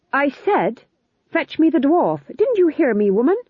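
full royal bluster; imperious kingly command. A narrator's reading. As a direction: order her about like an outraged king. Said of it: "got the spin and pitch of his voice just right".

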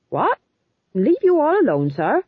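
high, loud incredulous question; taken aback. A narrator's reading. Sergeant told to leave the king alone, astonished. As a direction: question the order in disbelief.

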